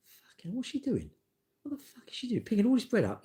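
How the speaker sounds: high, put-on outraged thought; indignant inner voice. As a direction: squeak out your disbelief. Inner thought about bread woman.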